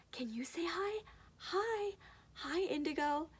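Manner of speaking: put-on high, sing-song baby talk; engaging a child. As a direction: coax a baby to say hi, pitched high. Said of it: "engage your child and say".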